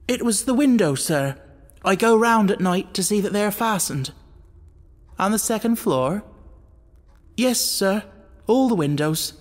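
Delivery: nervous butler. A narrator's reading. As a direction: talk your way out, scared. Frightened butler makes excuses.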